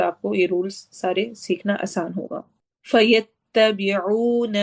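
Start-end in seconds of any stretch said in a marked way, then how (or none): 2.9-4.6 slow chanted recitation, عُو stretched; Quran recitation. Shown as a demonstration. Tajweed teacher reciting the verse for the class, chanted. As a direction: recite it slowly, drawing out the waw. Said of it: "واو مدہ کریں گے".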